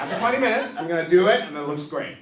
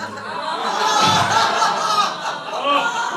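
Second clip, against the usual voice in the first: laughing; 6 dB louder than usual. Real, not acted. Laughs at his own joke.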